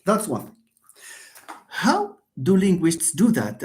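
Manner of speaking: ordinary talk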